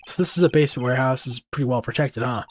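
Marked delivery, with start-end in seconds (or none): none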